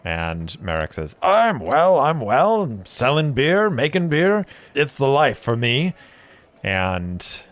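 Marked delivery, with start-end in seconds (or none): 1.2-5.9 high, loud jolly brewer voice; cheerful brewer. Acted. Brewer telling his old friend he's doing well, high and jolly. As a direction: beam about the brewing life.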